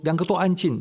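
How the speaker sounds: mock yell at mother and child; retold shout. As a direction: bark the order as the irritated doctor. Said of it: "他又大声说".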